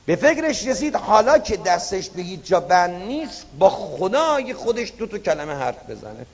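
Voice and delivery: usual speaking voice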